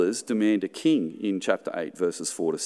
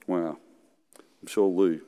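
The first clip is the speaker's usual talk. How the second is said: ordinary delivery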